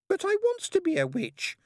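put-on very high childish wail; high-pitched plaintive little-girl voice; wailed protest. A narrator's reading. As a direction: whine it like a thwarted small girl. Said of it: "she wailed".

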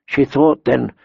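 usual speaking voice

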